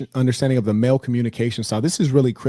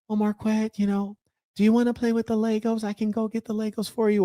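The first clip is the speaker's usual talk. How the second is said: higher and a bit softer, sweet kindergarten teacher; 8 semitones higher than usual. Retold or imitated. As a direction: coo to a small child. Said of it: "they talk in that sweet little soft voice".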